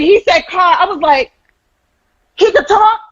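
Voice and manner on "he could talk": high-pitched incredulous question; stunned mom, voice shooting way up; astonished. Retold or imitated. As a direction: squeal it in amazed disbelief. Reliving shock at son's first word.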